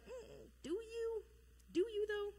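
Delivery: high, drawn-out doubtful questioning; mock skepticism. Jonah doubting God's plan.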